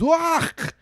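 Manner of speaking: loud, high mock Scottish accent. Angry neighbour ranting about accountability, mock Scottish accent. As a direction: ham up a Scottish brogue, shouting. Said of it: "opted on the side of Scottish".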